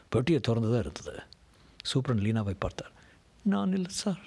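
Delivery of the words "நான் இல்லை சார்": high-pitched frightened denial; fearful pleading. A narrator's reading. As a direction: plead innocence to the officer, voice pitched up. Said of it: "என்று பயத்துடன் கெஞ்சினான்".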